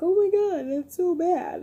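mock shocked friends; friends reacting. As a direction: imitate friends cringing. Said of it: "they're just like".

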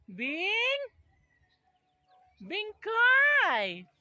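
high, loud call to a far-off goat. Real, not acted. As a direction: yell the name across the yard. Calling goat across farm. (malakas na pagtawag)

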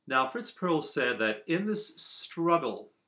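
ordinary talk

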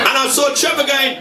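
normal talking voice

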